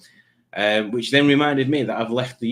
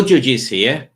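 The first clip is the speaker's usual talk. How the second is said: ordinary delivery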